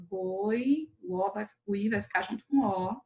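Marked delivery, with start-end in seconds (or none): 0.1-0.9 "goi" held as one joined syllable; isolated syllable. Shown as a demonstration. Teacher showing vowels that stay together, "goi" as one beat. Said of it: "as vogais podem ficar juntas".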